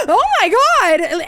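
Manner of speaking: loud, slow, high retold shriek of fright; startled scream. Retelling spotting the snake at the register, screaming. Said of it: "I screamed an actual scream".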